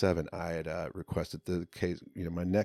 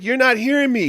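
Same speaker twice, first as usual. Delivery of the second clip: insistent guard; 12 dB louder than usual. Retold or imitated.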